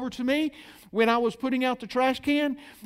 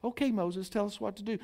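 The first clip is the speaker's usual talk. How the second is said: mock reverent; 9 dB quieter than usual. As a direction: plead humbly and quietly. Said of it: "reverently going".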